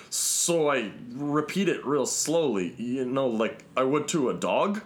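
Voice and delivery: spoken slowly, over-patient. Retold or imitated. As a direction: spell it out like to a dog. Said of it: "where he's like".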